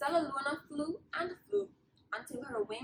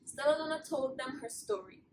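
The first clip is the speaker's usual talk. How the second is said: ordinary delivery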